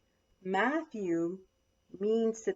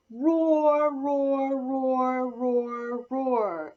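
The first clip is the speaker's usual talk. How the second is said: big drawn-out roars, higher, easing off; loud roaring; 4 semitones higher, 8 dB louder, much slower than usual. A narrator's reading. Lion brothers and sisters waking up, loud roaring. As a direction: roar it out at full voice, again and again. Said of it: "waking up and roaring loudly".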